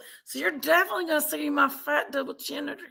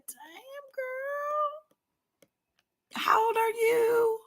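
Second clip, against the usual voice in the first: mock shocked voice; 7 semitones higher, 6 dB quieter, much slower than usual. Camera seeing her face from below.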